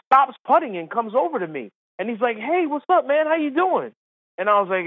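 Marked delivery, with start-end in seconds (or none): 2.4-3.9 high, chummy hello; friendly greeting. Retold or imitated. Golfer coming over to a supposed old friend, friendly. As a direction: greet like a long-lost pal, pitched up.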